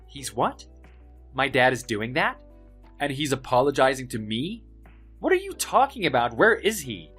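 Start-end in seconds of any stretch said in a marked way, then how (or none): 5.2-7.1 shocked disbelief. Acted. Bully shocked by the news.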